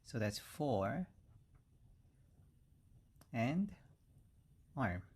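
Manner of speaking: "fore ... arm" pelan, dipisah; slow split. Shown as a demonstration. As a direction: pause between "fore" and "arm". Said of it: "the pronunciation is".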